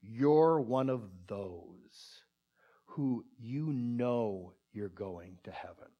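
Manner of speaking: low, very slow, seething mock contempt; angry sarcasm. Livid man answering a street evangelist, angry sarcastic imitation. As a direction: imitate a livid man drawing out each scornful word. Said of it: "he just said in a really angry voice".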